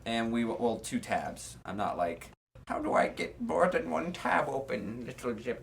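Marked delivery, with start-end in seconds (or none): 2.7-5.6 lightly voiced confused grandparent; a hint of a fumbling old person. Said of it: "I do an old person voice".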